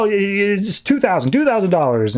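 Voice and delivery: flustered blurting. Retold or imitated. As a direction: imitate an eager crony butting in. Greedy crony blurts a new price.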